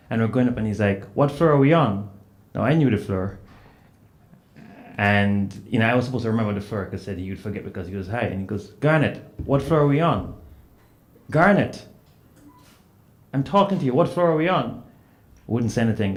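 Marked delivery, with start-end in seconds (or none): 1.1-2.1 higher, louder quoted question; retold insistent question. Retelling professor's question in elevator. 8.8-14.8 high, loud, insistent quoted questioning; retold impatient demand. Professor pressing for an answer, retold.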